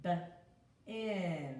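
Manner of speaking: B split off before in; sounding out bin. Shown as a demonstration. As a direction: break bin apart, with a small laugh. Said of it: "It's the short I".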